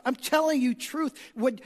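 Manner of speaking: ordinary talk, nothing marked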